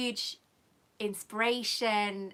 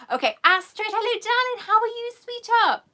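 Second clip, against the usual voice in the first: high, loud sing-song hello to a fan; high and loud, gushing with affection; sweet gushing greeting; 11 semitones higher, 8 dB louder than usual. Real, not acted. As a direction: coo it over like greeting a dear friend. Greeting a viewer in chat.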